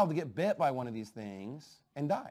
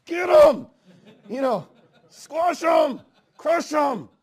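much higher, loud chant, egging on; mock cheering crowd; 10 semitones higher, 11 dB louder, slower than usual. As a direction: shout like fans urging a fighter on. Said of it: "It's like".

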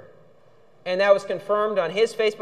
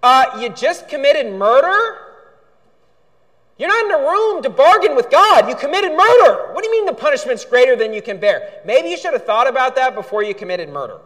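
louder and higher, incredulous rebuke; fiery preaching; 4 semitones higher, 5 dB louder than usual. Acted. Preacher scolds Cain for bargaining with God, loud and incredulous. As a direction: thunder at him in disbelief.

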